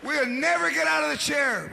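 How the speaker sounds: ordinary talk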